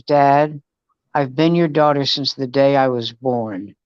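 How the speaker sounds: low, deadpan imitation of a fed-up daughter; grumpy daughter. Daughter telling dad she hates mornings, imitated grumpiness. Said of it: "Why are you so grumpy".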